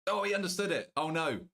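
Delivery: surprised. Real, not acted. Realizes joke landed on viewer.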